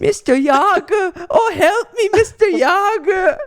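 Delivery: put-on high, loud, slow, terrible German accent. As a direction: wail it in a hammy German accent. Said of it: "It's the worst accent I've ever heard".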